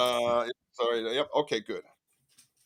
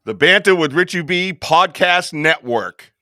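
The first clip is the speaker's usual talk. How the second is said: announcer reveal; 6 semitones higher, 9 dB louder than usual. Acted. Grand announcement of network.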